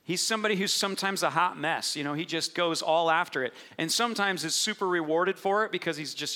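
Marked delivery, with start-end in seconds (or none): none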